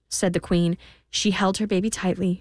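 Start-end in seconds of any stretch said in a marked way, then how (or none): none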